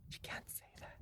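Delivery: whispered warning; soft whispered caution. Real, not acted. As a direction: hush it as a secret aside. Whispered aside.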